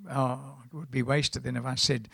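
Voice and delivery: normal talking voice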